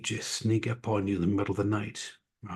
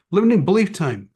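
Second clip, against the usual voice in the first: higher, louder mock announcement; calling out; 9 semitones higher, 7 dB louder, faster than usual. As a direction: call it out like a catch. Acting out calling out the belief.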